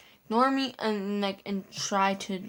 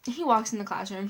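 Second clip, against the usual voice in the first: ordinary talk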